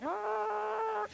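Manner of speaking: imitating his high startled "Ah"; a quick high cry as the truck slides; yelp of alarm. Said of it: "he's like".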